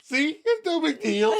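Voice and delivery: high-pitched mock tearful voice; Monica tearing up. Girlfriend tears up at the truth, mock tearful. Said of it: "And then Monica tears up".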